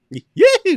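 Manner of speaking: high loud cowboy whoop; cowboy yahoo. Acted. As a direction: yell it like a rodeo cowboy.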